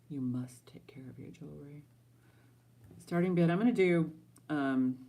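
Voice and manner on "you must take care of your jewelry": hushed, unvoiced aside; whispering. Real, not acted. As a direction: whisper it like a secret.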